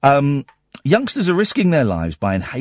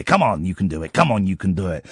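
self-coaching; 8 semitones lower, faster than usual. Retold or imitated. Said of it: "my old B B C head is, kicking into gear".

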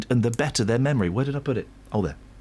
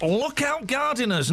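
high, hammed Manchester accent announcer; 8 semitones higher than usual. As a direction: shout the warning in Manchester accent. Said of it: "my Manchester voice".

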